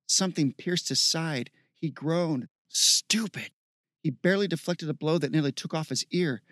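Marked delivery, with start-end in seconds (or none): 2.7-3.5 higher-pitched bitter exclamation; frustrated outburst, pitch raised; angry self-rebuke. Acted. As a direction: spit the word at yourself. Hero berates himself while fighting.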